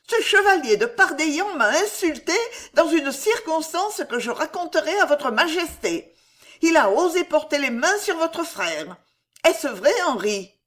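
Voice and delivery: high-pitched queen, loudly complaining; indignant queen. A narrator's reading. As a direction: lodge the complaint in a high, emphatic voice. Queen's voice in story. (reine indignée, voix aiguë)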